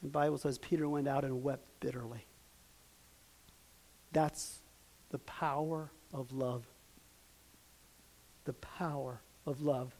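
preacher quietly awed by love; hushed reverence. Real, not acted. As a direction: let it fall to a near hush.

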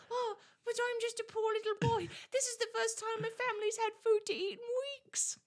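high, teary mock sob of a pitiful child; whiny poor little boy. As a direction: play the poor boy, pitch up high and whimper. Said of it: "And Charlie's like".

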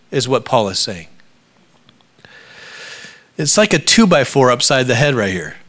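ordinary talk

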